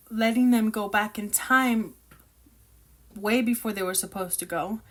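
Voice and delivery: ordinary delivery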